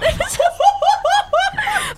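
high helpless laugh; shrieking laughter. Real, not acted. Laughing after camel noise.

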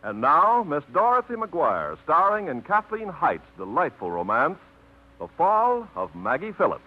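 ordinary talk, nothing marked

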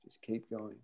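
a gentle whisper from beyond; soft grandparents' voice. Retold or imitated. Grandparents urging the grandson on, hushed and soft. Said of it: "I hear their voices so clearly".

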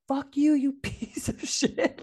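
mock road-rage yell; retold furious insult. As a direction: spit the insult out, half laughing. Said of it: "freak out on him".

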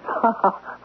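a quick breathy scoff of a laugh; short scornful laugh. Acted. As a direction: laugh her fear off with scorn. Mocking laugh at her fear.

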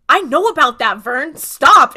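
loud mock-exasperated kid; loudly imitating a mortified kid; exasperated protest. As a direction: cut her off with a loud, acted stop. Acted embarrassed kid cutting Vern off.

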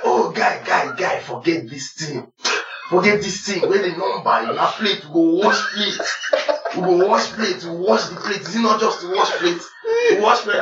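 lightly voiced friend pleading in pidgin; imitating Chidi. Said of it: "Chidi was like".

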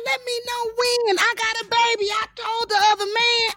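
high-pitched indignant wail; retold outburst of indignation; outraged protest. Acts outraged protest at audition.

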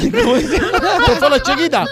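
hablado despacio entre risas; burlándose con risa; riendo. Real, not acted. Se ríe de la anécdota. (riendo de la niña)

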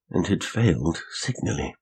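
ordinary delivery, nothing marked

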